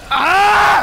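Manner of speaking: loud, high scream; deliberate scream. Real, not acted. Player screaming in case it helps, loud yell. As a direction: scream out at the game. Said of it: "If I scream".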